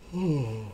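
a long soft tired yawn; real yawn. Real, not acted. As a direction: let a real yawn slip out mid-sentence. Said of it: "I'm yawning".

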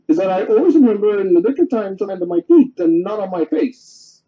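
usual speaking voice

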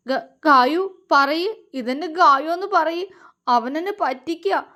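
a touch of tearful pleading, stammered start; mildly choked-up plea; teary. A narrator's reading. Vinod near tears begging Gayu, lightly tearful. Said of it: "കണ്ണ് നിറച്ചുകൊണ്ട് പറഞ്ഞു".

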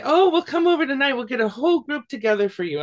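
high, delighted villagers offering to sing; eager village women. Retold or imitated. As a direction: promise gladly, high and lively. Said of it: "and they'll say".

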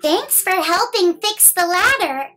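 usual speaking voice

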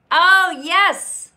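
loud, high cheer; excited yes. Real, not acted. Host cheering a viewer's new figure, loud and high. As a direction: shout your delight at the news.

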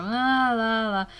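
lilting la-la imitation of how Americans sound; mock American sing-song. Telling viewers how Americans talk, mock lilting la-la. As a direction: sing it lightly, like rolling American speech. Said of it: "they kinda go".

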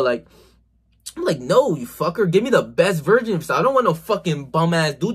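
usual speaking voice

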